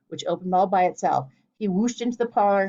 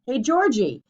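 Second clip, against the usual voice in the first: louder call down a hole; shouting down; 5 semitones higher, 5 dB louder, slower than usual. A narrator's reading. Linden calling down into the hole, shouting. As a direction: shout down to him in the pit. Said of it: "shouted down into the hole".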